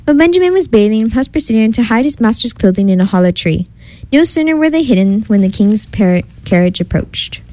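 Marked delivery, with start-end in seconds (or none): none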